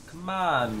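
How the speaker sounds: high, loud plea; pleading shout. Real, not acted. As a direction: urge the draw to come. Pleading at the game screen.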